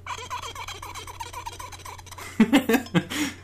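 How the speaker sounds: high rising mouth whoosh; magical whoosh sound effect. Shown as a demonstration. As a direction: make a magic poof sound. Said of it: "Sound effects one oh one".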